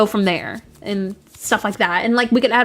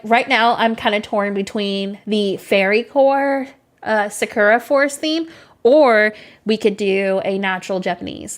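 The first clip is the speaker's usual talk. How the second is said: ordinary talk, nothing marked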